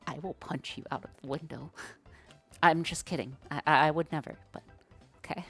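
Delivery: ordinary delivery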